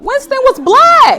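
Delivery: high, slow and drawn out; slow, high comic emphasis; high emphatic. Acted. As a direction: stretch it high and slow for the joke.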